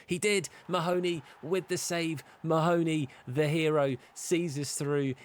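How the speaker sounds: high, loud celebrating commentary; excited. Real, not acted. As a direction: cheer the hero save. Winning shootout save.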